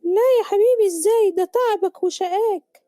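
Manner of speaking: high-pitched motherly refusal; protesting mother. Acted. Mother refusing her son's money, high protesting voice. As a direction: protest lovingly, pitched high, drawing out the end.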